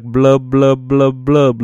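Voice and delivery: gurgling fish noise; catfish blub. Pretend. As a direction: mouth it like a fish blowing bubbles. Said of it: "Look at me, I'm a catfish".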